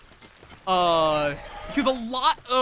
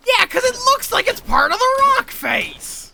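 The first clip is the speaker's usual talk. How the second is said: loud, high exasperated protest at the game; exasperated outburst; 8 dB louder than usual. Real, not acted. Complaining to the game about hidden ore, exasperated shout. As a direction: throw up your hands and shout it.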